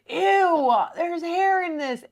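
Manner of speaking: high, drawn-out mock disgust; disgusted whine. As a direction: whine it high, grossed out by the food. Acts out Clayton finding hair in food.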